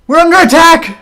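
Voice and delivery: high, very loud shout of alarm; alarmed yell. Acted. Guard raising the alarm after a gunshot, yelling. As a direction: yell out a warning to everyone. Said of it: "you hear him yell".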